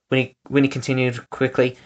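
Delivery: plain reading voice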